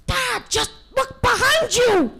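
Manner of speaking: very high, loud, slow yell; urgent yell. Acted. Little wolf urging his father to look, high urgent yell. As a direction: yell a warning to dad. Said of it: "he yelled".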